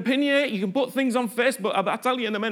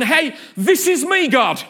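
loud, raised, fervent cry to God; David's all-out declaration; 4 semitones higher, 4 dB louder than usual. Acted. David stripped of externals crying to God, loud and fervent. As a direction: proclaim total devotion at full voice. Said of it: "getting rid of all the external stuff and going".

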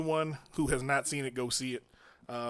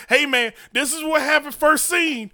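excited spoiler; 8 semitones higher, 7 dB louder than usual. Pretend. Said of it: "It'd been like".

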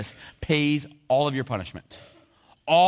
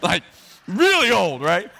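put-on high, loud squeal of "really old"; comic exaggeration; 6 semitones higher, 8 dB louder, slower than usual. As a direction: squeak it out for the laugh. Comic exaggeration of wife's age.

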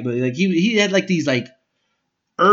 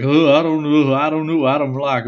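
Boomhauer mumble; 4 dB louder than usual. Retold or imitated. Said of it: "he's like Boomhauer a little bit".

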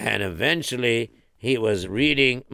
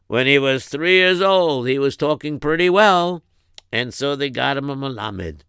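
plain reading voice

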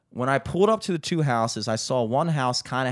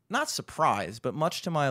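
normal talking voice

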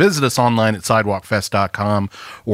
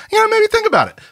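coy hint; much faster than usual. Acted. Hinting a sponsor should pay.

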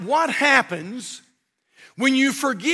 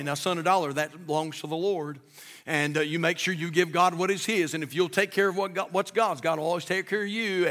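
fatherly advice, soft; 5 semitones lower, 7 dB quieter than usual. Retold or imitated. Dad tells son a dollar belongs to the Lord, softly and low. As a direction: lower and much softer, gentle counsel to a boy. Dad's advice voiced in story.

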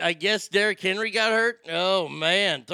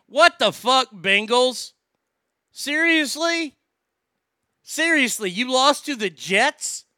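louder, much higher outraged disbelief; exasperated disbelief; 6 semitones higher, 5 dB louder than usual. Real, not acted. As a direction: yell at the team in disbelief. Outraged at Bengals losing.

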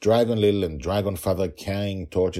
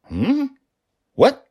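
very high, loud, baffled questions; startled, as if mishearing himself; taken aback, pitch leaping up; surprised; 8 dB louder, slower than usual. A narrator's reading. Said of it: "He seemed surprised".

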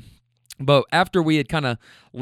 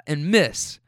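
ordinary talk, nothing marked

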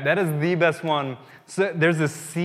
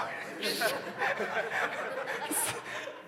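breathless laugh; 9 semitones higher, 8 dB quieter than usual. Real, not acted. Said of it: "I'm just weeping".

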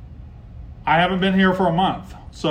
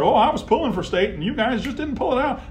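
mocking Ole Miss fan; 8 semitones higher than usual. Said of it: "They like to say".

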